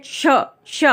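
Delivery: श said as a clear 'sha'; pronunciation demo. Shown as a demonstration. Teacher showing learners how the conjunct is pronounced, careful demo. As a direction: model the श sound for learners. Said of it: "जो उच्चारण करते हैं वो करते हैं".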